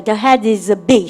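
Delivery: very slow model line; slow drill read. Shown as a demonstration. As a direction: draw it out for the class. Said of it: "해보세요". (천천히 끊어 읽기 시범)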